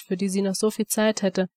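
ordinary delivery

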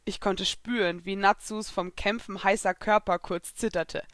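ordinary delivery, nothing marked

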